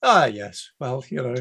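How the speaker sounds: ordinary talk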